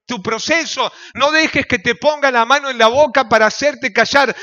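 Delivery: loud, ringing pulpit preaching; fiery sermon voice; preaching at full force. Acted. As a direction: preach it out to the whole church. Pastor preaching to congregation.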